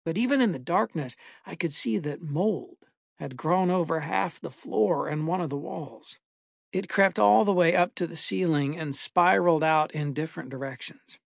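ordinary delivery, nothing marked

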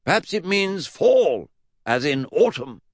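high, slow, loud theorising; Mad Uncle Jack. Acted. Great-uncle offers Eddie a theory, high emphatic voice. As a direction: stretch the key word high.

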